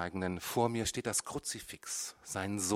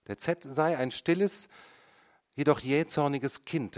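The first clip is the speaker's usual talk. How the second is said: high, louder voice of the mother; mother testifying; 6 semitones higher, 5 dB louder than usual. A narrator's reading. Mother testifies about her son, high and loud. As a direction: give her testimony pointedly.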